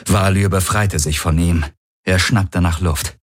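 ordinary delivery

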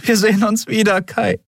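high, emotional farewell; promising. A narrator's reading. Andriel in tears parting from Kai, promising. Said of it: "versprach sie".

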